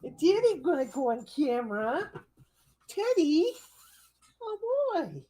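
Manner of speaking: high, sing-song coo to a puppy. Real, not acted. Owner cooing high at her poodle on camera. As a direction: coo up high at the sleepy pet.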